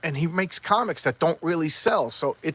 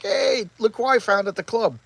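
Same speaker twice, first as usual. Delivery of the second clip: higher-pitched mock-cheery call; excited announcement; 7 semitones higher, 4 dB louder than usual. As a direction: imitate someone showing off a surprise find. Acting boyfriend being greeted with news.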